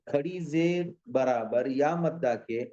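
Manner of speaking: ordinary delivery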